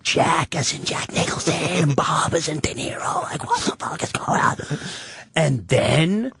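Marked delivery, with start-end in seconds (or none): 0.0-4.6 stunned whisper to himself; inner whisper. Retold or imitated. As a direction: whisper it in disbelief. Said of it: "And I'm just like".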